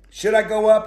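a king keen to march off to battle; eager king. Acted. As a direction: Ahab hungry for a yes. Ahab asking the prophet.

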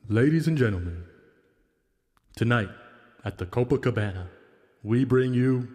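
booming ring announcer, echo added; announcer with reverb. Pretend. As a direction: announce it like a luchador emcee with reverb. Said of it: "don't forget to add the reverb".

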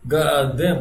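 normal talking voice

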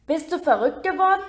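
high exasperated yell; bellowing. Acted. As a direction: roar it at her in disbelief. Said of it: "brüllte Ron".

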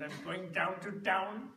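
put-on slow Peter Sellers style Indian accent. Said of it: "when he imitates the Indian accent".

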